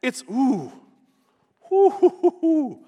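high hooting gasp of amazement; amazed hooting. Pretend. Preacher to congregation, pretending to reel at how dead it is, amazed hooting. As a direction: let out a rising, whistling ooh-hoo of awe.

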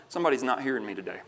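ordinary talk

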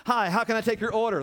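mock a waiter's greeting; fake-cheerful server. Preacher mimicking a restaurant server greeting.

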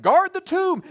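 high, loud barked command; loud order. Retold or imitated. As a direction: shout it like a commander. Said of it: "And they're like".

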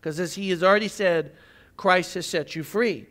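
ordinary delivery

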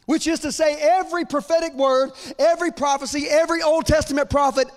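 climbing sermon fervor; full-force preaching. Acted. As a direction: preach it up to a climax.

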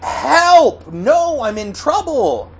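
very high, urgent acted yell; yelling for help. Hurt child whom nobody answers, high yell. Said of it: "you yell out".

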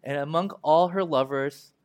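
slow, weighty reading of the lament; mournful Bible reading; solemn scripture reading. Acted. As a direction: read each line slowly, letting it sink in. Reads the lament aloud.

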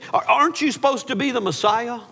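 high, scornful taunt; mocking. Criminal mocking Jesus with the crowd, high scornful taunt. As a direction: toss the question up high in derision. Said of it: "he mocks Jesus".

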